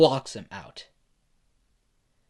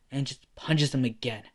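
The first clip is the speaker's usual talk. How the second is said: usual speaking voice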